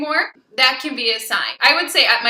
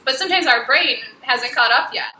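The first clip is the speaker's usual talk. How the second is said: ordinary talk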